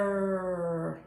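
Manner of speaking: FEATHER very slow, both syllables held long; long held vowels in Feather, sound by sound; stretched-out word. Shown as a demonstration. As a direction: stretch Feather out on a low, even tone. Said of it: "Let's stretch it out".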